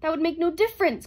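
high, loud protest; crying out. A narrator's reading. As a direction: cry it out in agitation. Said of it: "cried Herbie".

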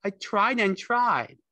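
high, loud, slow plaint; pleading Lenny. A narrator's reading. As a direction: whine it out to an aunt. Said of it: "Lenny moaned with grief".

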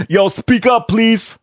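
hammed-up high New York accent. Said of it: "My New York accent's horrible".

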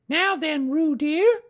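high-pitched motherly summons; Kanga's voice. A narrator's reading. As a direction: address the child in a high character voice. Kanga to supposed child.

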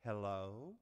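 low, soft imitation of a whispering child; child's whisper. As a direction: say it small and hushed, drawn up like a question. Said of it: "who whispered".

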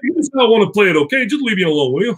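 hammed-up annoyed character brushing someone off; mock grumpy Ranger. Actor riffs back at the host in a mock exasperated voice.

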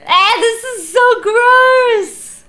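high, loud, drawn-out cry of disgust. Real, not acted. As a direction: squeal it out slowly, totally grossed out. Rat under the bed.

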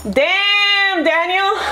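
lightly voiced meme quote; mock meme voice. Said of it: "Damn, Daniel meme".

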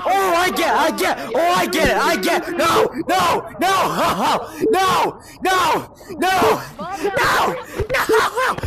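loud, high frantic yelling; panicked shouting. Real, not acted. Gamer yelling as the bomb game turns on him, panicked. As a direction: yell frantic, repeated protests.

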